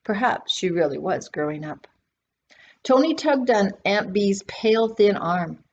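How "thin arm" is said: usual speaking voice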